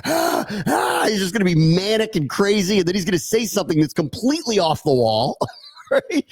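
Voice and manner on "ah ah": high, breathy flustered 'ah, ah' sputters; mock huffing. Host imitating the coach huffing after a loss, high sputter. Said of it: "he's just huffing and puffing".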